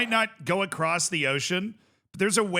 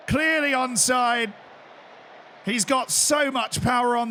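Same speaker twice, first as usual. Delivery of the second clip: roaring, high-pitched commentator call, easing as the replay runs; excited match commentator in full cry; football goal commentary; 8 semitones higher, 4 dB louder, slower than usual. Pretend. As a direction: shout the goal with long drawn-out words, then settle. Plays goal commentator over the game.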